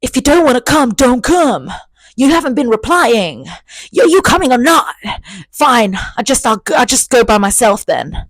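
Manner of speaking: hammed-up annoyed boyfriend; sulky demanding text. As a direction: read his texts like a pushy whiny boyfriend. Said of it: "he was texting me".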